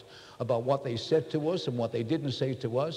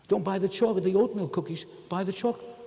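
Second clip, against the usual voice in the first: mock quoting wife; 7 semitones higher, 3 dB louder, faster than usual. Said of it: "She said".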